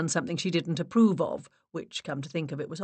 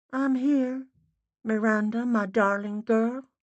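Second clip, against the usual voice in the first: higher-pitched, muffled Boston voice; Boston woman spirit; 6 semitones higher, 4 dB louder than usual. A narrator's reading. Dead mother greeting her daughter, Boston accent. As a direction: call tenderly from the other side. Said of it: "a woman speaking with a Boston accent".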